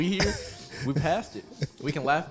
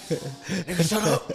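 mock gym outburst; 6 semitones higher, 4 dB louder, much slower than usual. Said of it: "Could you imagine just".

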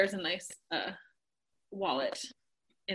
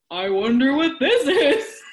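slow, louder, higher taunt; drawn-out tease; 4 semitones higher, 7 dB louder, slower than usual. Retold or imitated. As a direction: stretch it out to bait her. Husband repeats the tease louder.

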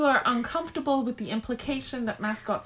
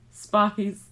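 normal talking voice

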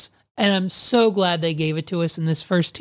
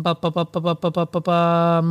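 rapid "buh-buh-buh" noodling; absent-minded ba-ba-bum while busy; idle vocal filler; 2 semitones lower than usual. Real, not acted. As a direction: fill time with bouncy nonsense syllables. Killing time while typing a text.

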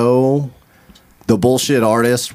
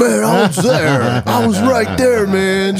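whiny protest; 7 semitones higher, slower than usual. Retold or imitated. Friend voicing his protest.

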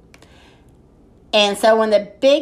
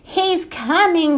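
high, drawn-out storybook call; warning shout; 6 semitones higher, slower than usual. A narrator's reading. Ted warning Ziggy about the wolf, shouting. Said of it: "shouted Ted".

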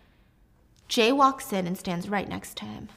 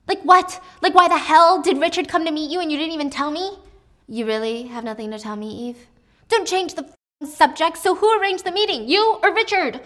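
angry shouting; 7 semitones higher, 6 dB louder than usual. Acted. Eve shouting at Jay.

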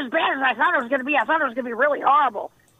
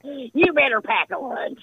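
usual speaking voice

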